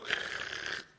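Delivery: rasping rush of coins; imitated coin-changer noise. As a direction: make the rattle of coins pouring out. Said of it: "and go".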